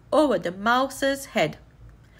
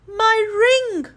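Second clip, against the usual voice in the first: high, loud cry of dismay; loud, higher alarmed cry; 10 semitones higher, 5 dB louder than usual. A narrator's reading. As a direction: wail for the lost ring. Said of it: "cried Miss Plum".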